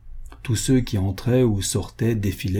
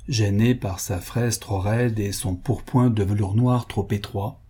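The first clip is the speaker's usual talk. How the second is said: ordinary delivery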